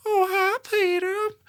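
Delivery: put-on very high, creepy old man's greeting; creepy old neighbor Herbert greeting Peter in a very high voice. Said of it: "he was like".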